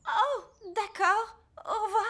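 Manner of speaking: dismayed whimper. Acted. Madame Elf, left in charge of the class, whimpers in dismay. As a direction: sound overwhelmed and close to crying.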